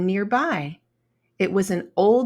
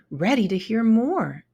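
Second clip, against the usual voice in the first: ordinary delivery, nothing marked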